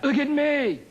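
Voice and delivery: high, slow bragging elder voice; bragging old person. Retold or imitated. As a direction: crow about your age like an old-timer. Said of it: "if they brag about their age".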